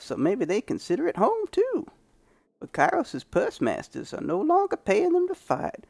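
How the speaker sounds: put-on high, grizzled old prospector; old man prospector voice. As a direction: play a crusty old prospector. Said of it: "doing a freaking old man prospector voice".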